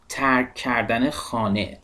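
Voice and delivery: ordinary talk, nothing marked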